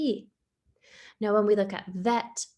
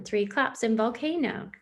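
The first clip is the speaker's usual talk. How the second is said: usual speaking voice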